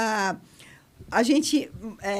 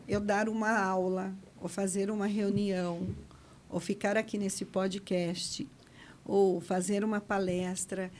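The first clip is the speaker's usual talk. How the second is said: low, slow, softer monotone drone; 5 semitones lower, 7 dB quieter, slower than usual. Shown as a demonstration. Coach demonstrating a monotone talk, slow and low. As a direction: show the boring single-pitch delivery, slow and dull. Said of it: "sempre nesse mesmo tom que nós podemos chamar de monotom".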